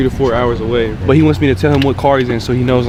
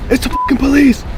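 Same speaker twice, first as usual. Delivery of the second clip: high-pitched mock warning yell; retold yell; 13 semitones higher than usual. Scammer retells yelling from the window, high mock alarm. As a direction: imitate yelling a police warning, pitch shooting up. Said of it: "and I yelled".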